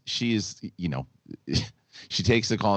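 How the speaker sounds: ordinary talk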